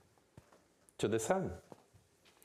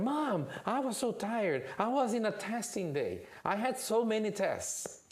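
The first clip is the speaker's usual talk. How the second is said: put-on high, complaining kid voice; whiny kid; 10 semitones higher than usual. Junior whines to mom about his testing day, high-pitched. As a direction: plead tiredness like a ten-year-old. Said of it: "And Junior said".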